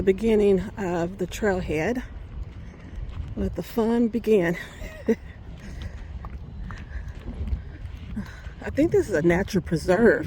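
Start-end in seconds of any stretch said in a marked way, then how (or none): 4.6-8.6 long laugh trailing into breath. Real, not acted. Vlogger at the trailhead, long trailing laugh. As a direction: laugh it out, then let it fade.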